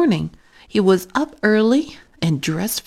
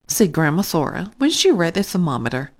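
usual speaking voice

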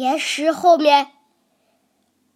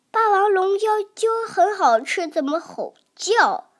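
usual speaking voice

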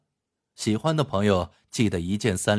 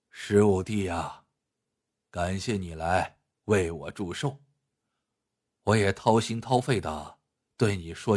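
grave elder brother Huo Sanye, confiding; 4 dB quieter than usual. Acted. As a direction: confide gravely, pausing between phrases. (霍三爷低沉郑重地倾诉)

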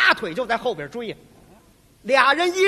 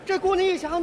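ordinary talk

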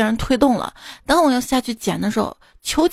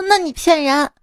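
higher, indignant accusing, slowing at the end; angry child; 5 semitones higher than usual. Retold or imitated. Grandson scolding grandpa for lying about money, angry. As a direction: call him a liar in a childish huff. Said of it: "我当即就生气了".

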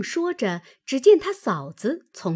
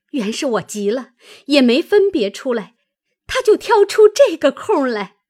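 loud, mildly heated explaining, higher at the end; indignant complaint; 3 semitones higher, 7 dB louder than usual. A narrator's reading. Maid exposing her sister-in-law's trick, indignant. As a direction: build to an exasperated high finish.

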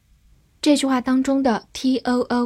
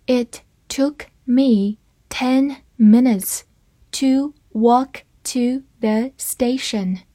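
slow and deliberate, word by word; slow reading; slower than usual. Shown as a demonstration. Teacher reads the sentence slowly to students, slow and clear. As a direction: read it slowly for the class. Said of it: "我们慢读一遍这个句子".

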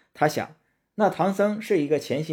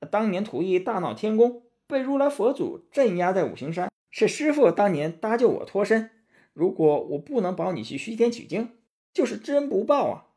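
high, animated monkey disciple; Sun Wukong pleading; 9 semitones higher than usual. Acted. Sun Wukong reminds his master who rescued him, high pleading voice. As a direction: argue earnestly up in a high voice.

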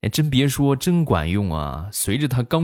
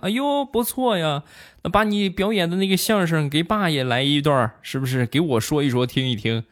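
imitating pleased dad; 8 semitones higher than usual. Dad delighted, asks performance.

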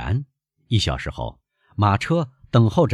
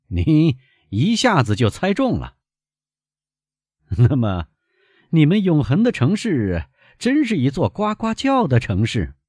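put-on higher, livelier young Parisian; a hint of an amused traveller; lightly voiced young gentleman; 9 semitones higher, 5 dB louder than usual. A narrator's reading. Franz and Albert voiced. (略带调侃的年轻绅士语气)

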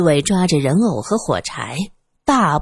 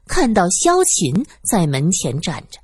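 ordinary delivery, nothing marked

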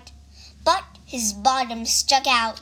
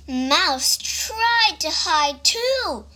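usual speaking voice

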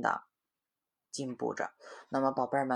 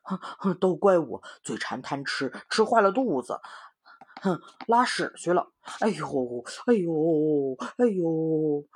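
moaning pig voice, louder, with fake pain; groaning Pigsy; 3 semitones higher, 6 dB louder than usual. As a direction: groan like a greedy pig with a tummy ache. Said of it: "猪八戒皱着眉头说". (假装肚子疼的猪八戒哼哼声)

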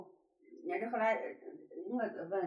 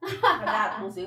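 laughing; 10 dB louder, slower than usual. Real, not acted. Laughing while retelling age joke.

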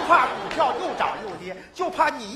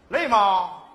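loud mock-sweet boss asking; fake-caring boss; 7 dB louder than usual. Boss feigns concern for a young worker, loudly. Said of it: "他假惺惺地跟我说".

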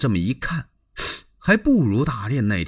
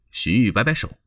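plain reading voice

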